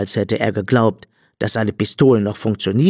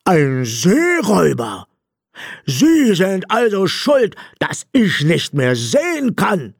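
high, slow, outraged robber voice; wailing indignation in a put-on voice; indignant; 9 semitones higher, slower than usual. A narrator's reading. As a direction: accuse him in a high, drawn-out cry. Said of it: "rief der blinde Räuber empört".

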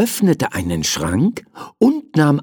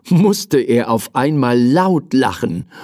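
ordinary delivery

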